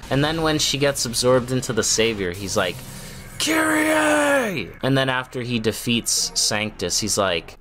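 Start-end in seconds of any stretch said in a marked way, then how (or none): 3.4-4.7 high, drawn-out mock scream of a name; hammed-up dramatic yell, pitched way up; Nero yelling. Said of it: "inexplicably yelling her name".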